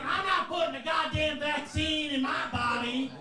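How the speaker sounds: high-pitched, indignant refusal; defiant shouting. Acted. Relatives refusing to get vaccinated, shouting defiantly.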